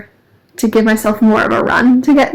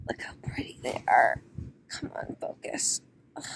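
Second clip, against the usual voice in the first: breathy unvoiced whisper, much softer; 12 semitones lower, 18 dB quieter than usual. Real, not acted. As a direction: hush it to yourself. Whispering to herself on walk.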